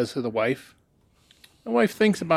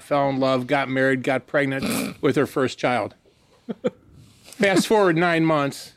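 normal talking voice

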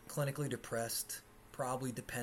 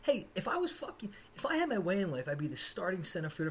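annoyed retort; 6 semitones higher, faster than usual. Real, not acted. Snapping back at tourists.

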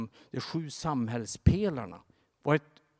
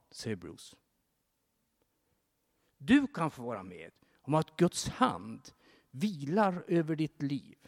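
ordinary talk, nothing marked

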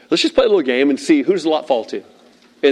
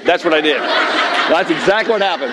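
usual speaking voice

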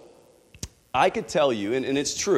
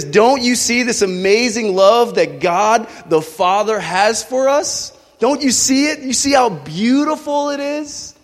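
much higher and louder, ringing proclamation; 10 semitones higher, 7 dB louder than usual. Shown as a demonstration. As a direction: proclaim it in awe. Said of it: "it's a proclamation of God's love".

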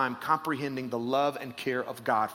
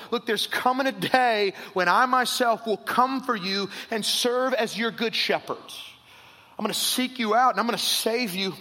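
higher, building preached promise; preaching God's promise; 6 semitones higher, 4 dB louder than usual. Acted. Pastor voices God's promise to his people, preaching. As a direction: lift each I'm gonna higher.

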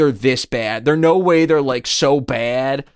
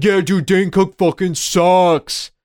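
lightly mocked bandwagon hater, words dragged out; 2 semitones higher, slower than usual. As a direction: draw it out like a dismissive bro. Mimicking everyone's sudden hate for comedian.

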